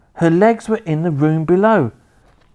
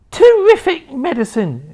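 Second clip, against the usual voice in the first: very high, very loud screechy crone whoop; wild old woman's shout; 10 semitones higher, 5 dB louder than usual. A narrator's reading. Grandma rising through the floor, screeching with glee. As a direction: whoop with mad joy. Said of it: "she cried".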